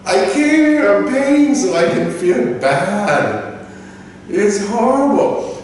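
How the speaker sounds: high, drawn-out mock indignation; exasperated complaint. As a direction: wail the grievance, stretching the ends. Mock outrage at feeling guilty.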